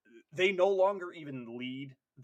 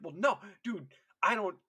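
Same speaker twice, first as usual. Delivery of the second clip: higher-pitched, exasperated objection; mock alarmed protest; 5 semitones higher than usual. Squadmate doubting a comrade gone stage three, alarmed. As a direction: argue like a worried squadmate. Teammate arguing over deranged comrade.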